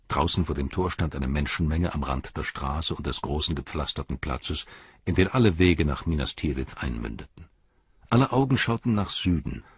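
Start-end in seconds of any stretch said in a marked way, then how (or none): none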